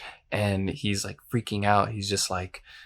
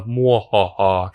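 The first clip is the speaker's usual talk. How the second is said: slow, loud hammed-up mwahaha; mock evil laugh; 6 dB louder, slower than usual. Describing the temptress's laugh, a hammed-up evil mwahaha. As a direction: play a cheesy movie villain laughing. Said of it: "she kinda has this evil".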